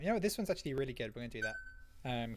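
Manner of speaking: ordinary talk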